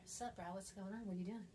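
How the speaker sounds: a hushed, suggestive sup bro; late-night text voice. Retold or imitated. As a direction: murmur it like a late-night booty call. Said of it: "that's a two A M text".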